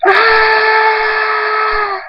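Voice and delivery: long, very high sustained scream. A narrator's reading. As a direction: scream at length as if overpowered. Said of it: "Thunderstorm screams".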